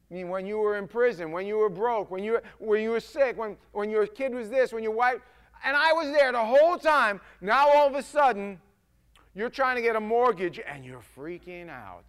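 loud scolding list, building to a shout; the Lord, exasperated. Acted. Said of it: "imagine the Lord up in heaven going".

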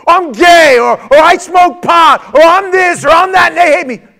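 high, loud rant; aggrieved shouting. Acted. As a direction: hurl each grievance out. Aggrieved churchgoer lists grievances.

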